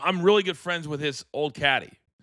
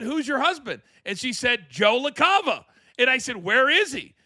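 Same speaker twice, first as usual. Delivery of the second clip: eager questions; 7 semitones higher than usual. Retold or imitated. Retold excited exchange.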